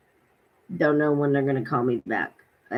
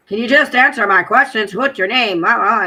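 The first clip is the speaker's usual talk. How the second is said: mock exasperation, raised voice; exasperated; 6 semitones higher, 7 dB louder than usual. Retelling a caller demanding answers to his questions, exasperated. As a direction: imitate a loud, higher, fed-up official. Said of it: "he was getting exasperated".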